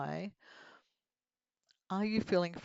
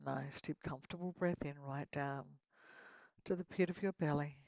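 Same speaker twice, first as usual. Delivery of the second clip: guided meditation; 6 dB quieter than usual. Acted. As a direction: lead them gently into relaxation.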